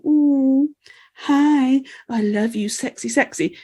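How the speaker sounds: teasing fake come-on; mock flirting. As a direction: imitate someone sidling up seductively. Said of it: "sidling up to men going".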